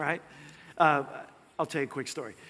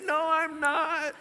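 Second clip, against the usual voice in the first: high, drawn-out defiant cry; shouted retort; 10 semitones higher, much slower than usual. Retold or imitated. Driver answering the angrier preacher, shouting defiantly. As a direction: call it back slowly, pitched way up.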